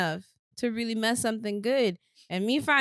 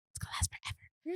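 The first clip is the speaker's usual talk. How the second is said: hushed, voiceless aside imitating onlookers; much softer, whispered imitation; mock gossip whisper; 9 semitones higher, 13 dB quieter than usual. Mimicking gossips doubting the relationship.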